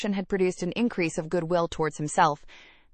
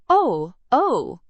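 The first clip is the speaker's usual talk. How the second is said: loud, higher knowing cry; a hearty teasing exclamation; cried out; 5 semitones higher, 7 dB louder than usual. A narrator's reading. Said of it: "cried Missus Jennings".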